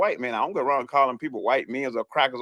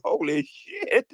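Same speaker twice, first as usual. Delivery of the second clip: high, slow, drawn-out "Holy shit"; a retold gasp of amazement; stunned disbelief; much slower than usual. Caller hearing the judge's rant, retells his stunned much higher reaction. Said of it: "I was sitting like".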